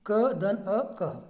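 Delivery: ordinary delivery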